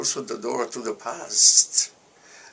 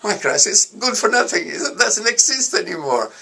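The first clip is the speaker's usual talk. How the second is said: louder and higher, crisis shrugged off; relieved outburst; 8 semitones higher, 8 dB louder than usual. Retold or imitated. Student after the professor shuffles away, relieved and louder. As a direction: burst out with relief and delight. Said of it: "and I said".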